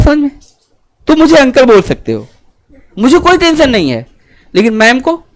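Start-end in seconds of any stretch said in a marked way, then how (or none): none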